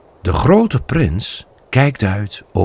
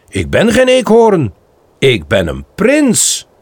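proud and brave; 7 semitones higher than usual. Acted. Bambi acting brave.

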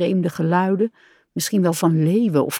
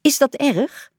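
high, worried question; mildly frightened; alarmed; 8 semitones higher than usual. A narrator's reading. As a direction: ask anxiously, pitch well up. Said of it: "riep Kikker verschrikt".

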